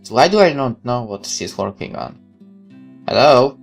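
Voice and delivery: ordinary delivery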